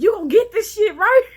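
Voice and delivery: high, loud mock God voice through laughter; God scolding, laughing. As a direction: scold yourself as God, cracking up. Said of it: "God was, like, knocking my ass".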